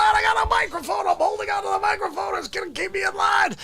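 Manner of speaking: put-on a drunk losing his balance, shouting; drunk wobbling. Drunk character falling over, shouting.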